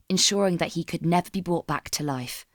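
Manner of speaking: ordinary delivery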